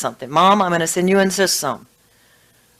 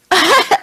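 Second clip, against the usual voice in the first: high, loud laugh bursting out; a sudden bright laugh; burst of laughter; 13 semitones higher, 7 dB louder than usual. Real, not acted. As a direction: laugh at your own joke. Laughing at own joke.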